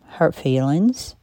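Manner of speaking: ordinary talk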